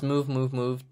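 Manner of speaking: held-back mock command; uncommitted imitation. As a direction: imitate a half-hearted order without yelling. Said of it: "that doesn't work for me".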